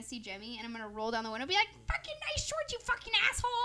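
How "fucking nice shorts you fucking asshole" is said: very high-pitched jeering call; high comic taunt; mock heckle. Acts out heckling from car.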